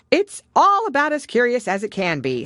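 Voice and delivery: loud, high Gryphon; a bold creature agreeing heartily. A narrator's reading. Gryphon voiced.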